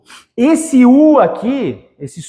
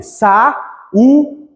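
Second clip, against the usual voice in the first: "Sa-u-de" in three separate beats with pauses; syllable separation; 2 semitones higher than usual. Shown as a demonstration. Teacher showing syllable division of saúde, separated beats. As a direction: break "Sa-u-de" apart, pausing after each syllable. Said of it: "separação silábica de saúde".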